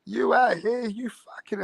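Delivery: high-pitched furious rant; imitating enraged woman. Imitating the girlfriend ranting at her partner, high and angry. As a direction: rant at the cheater. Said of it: "She goes".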